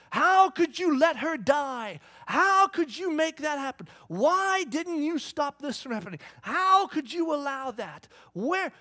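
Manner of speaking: loud, high, slow accusing cry; blaming God. Retold or imitated. People blaming God for a death, anguished and loud. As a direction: shake your fist at heaven in words. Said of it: "they shake their fist at me and blame me".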